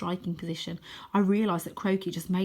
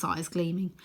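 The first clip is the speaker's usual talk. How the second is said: plain reading voice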